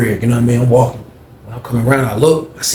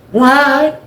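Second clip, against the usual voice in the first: much higher, miffed; Greg's high huffy voice; 12 semitones higher, 4 dB louder than usual. Retold or imitated. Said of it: "he was like".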